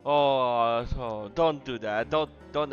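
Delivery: normal talking voice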